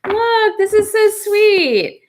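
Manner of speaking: high, delighted and slowed down; gushing over something sweet; touched and delighted. Real, not acted. Moved by the reading.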